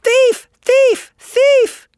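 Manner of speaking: very high, piercing bird-like cry; shrill scream. A narrator's reading. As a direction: shriek it out in short, shrill bursts. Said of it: "there came a shrill scream of".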